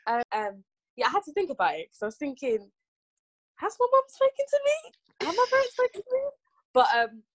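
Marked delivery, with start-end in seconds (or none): 3.6-6.2 high incredulous inner voice. Retold or imitated. Retold inner question, high.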